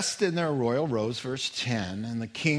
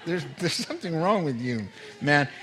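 much higher chiding reproach; mock scolding; 4 semitones higher than usual. Jehoshaphat chiding Ahab, pitched high. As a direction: tell him off in gentle disbelief. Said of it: "He just looks at him and goes".

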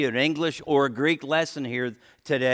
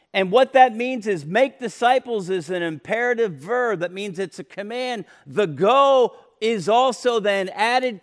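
higher, louder preacher's insistence; preaching at full force; 7 semitones higher, 6 dB louder than usual. Acted. Preacher urging the congregation to obey, fervent preaching. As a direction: drive the command home.

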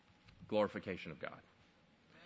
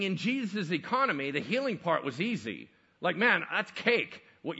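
louder, punchy pulpit voice; fervent preaching; 7 semitones higher, 7 dB louder than usual. Acted. Preacher mocking fake healers to the congregation, loud and fervent. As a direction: hammer each phrase home.

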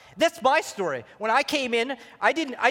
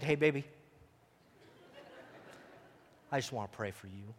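much lower, much softer, tender romantic murmur; 9 semitones lower, 13 dB quieter than usual. Shown as a demonstration. Husband tenderly offering to pray for his wife, soft romantic murmur. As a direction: coo it low to your wife. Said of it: "You say it with that voice too".

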